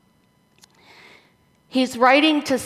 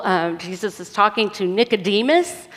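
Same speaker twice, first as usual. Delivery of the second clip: ordinary talk, nothing marked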